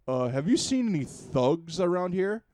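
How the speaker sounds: loud, wooden question rising at the end; stiff game hero voice. Retold or imitated. Gamer acts the hero's wooden question, loud. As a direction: ask it like stilted game dialogue. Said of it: "That's a real line".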